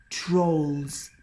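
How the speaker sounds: usual speaking voice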